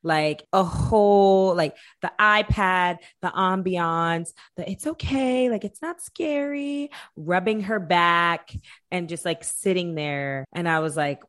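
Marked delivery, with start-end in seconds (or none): 4.7-6.9 high, gentle reassurance; calm a scared child, high; soothing. Retold or imitated. Comforting the niece on the toilet, high and gentle.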